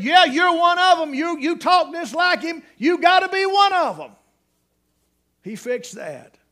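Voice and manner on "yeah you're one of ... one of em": high, loud, gleeful "gotcha" shout; mocking accusation. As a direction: catch him out and crow about it. Bystanders recognise Peter's country voice.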